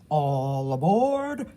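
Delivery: slow, drawn-out conductor call; lightly voiced driver hooting. A narrator's reading. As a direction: stretch it out like calling passengers aboard. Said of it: "Ken Slashneth hoots".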